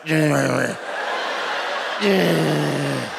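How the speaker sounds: put-on kids sneering at a driver; mock evil kids. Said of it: "just like".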